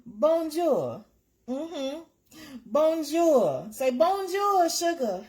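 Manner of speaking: high, loud playful French hello; excited bonjour. Acted. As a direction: sing out a gleeful bonjour. Playful French greeting to viewer.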